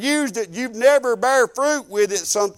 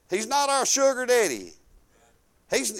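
ordinary delivery

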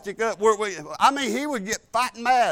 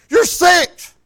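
loud stern reminder; 8 semitones higher, 12 dB louder than usual. Retold or imitated. Scolding grandson for pouting.

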